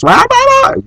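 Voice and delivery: high squeaky mocking blah blah; sing-song mockery of a nagging wife. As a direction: dismiss her words in a silly high voice.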